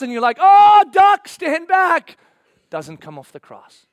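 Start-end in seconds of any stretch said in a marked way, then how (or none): 0.4-2.2 high, very loud warning; alarmed shout. Acted. Follower expecting power from the cross, shouting a warning. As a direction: shout as if something is about to blow. Acts follower bracing for divine response.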